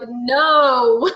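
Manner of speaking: high, loud mock wail back; exaggerated loud refusal, retold; retold loud protest. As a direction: call it back loudly, half laughing. Acted shouted reply to yelling son.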